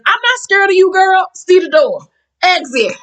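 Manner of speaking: high-pitched imitation of standing up to someone; high, sassy send-off, easing down at the end; mock defiance. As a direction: defy them in a high voice, then dismiss. Acting out Mary's defiant dismissal.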